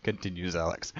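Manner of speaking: ordinary talk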